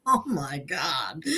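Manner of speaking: normal talking voice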